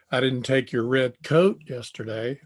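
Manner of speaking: "coat" stressed with a rise. Shown as a demonstration. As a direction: punch "coat", not a sweatshirt. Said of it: "I took a sweatshirt".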